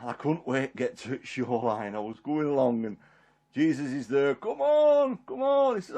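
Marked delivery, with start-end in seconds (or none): 4.3-5.8 high, light urging call; re-enacted eager beckoning. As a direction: call him over from the shore. Jesus beckons him ashore.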